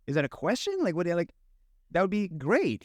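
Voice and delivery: excited disbelief. Retold or imitated. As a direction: gush like you can't believe the offer. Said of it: "I'm like".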